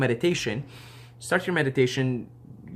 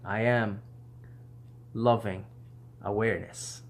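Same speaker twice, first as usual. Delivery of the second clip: mean every word, let it sink in; sincere affirmation fading softly; with feeling; 2 semitones lower, 7 dB quieter than usual. Shown as a demonstration. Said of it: "let me actually say it with a feeling".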